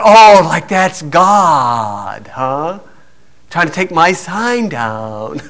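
drawn-out, dropping mock awe; mocking mimicry. Woman credits God for the wind, mocked.

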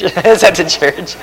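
slow words broken up by laughter; laughing. Real, not acted. Parent telling about the forgotten Bible, laughing. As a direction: really crack up while saying it. Cracking up at own story.

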